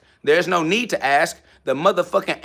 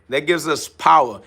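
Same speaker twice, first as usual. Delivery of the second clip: ordinary talk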